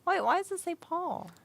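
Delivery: high-pitched baffled questioning; incredulous surprise. Real, not acted. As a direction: react in puzzled disbelief, pitched high. Startled by wrong name on stream.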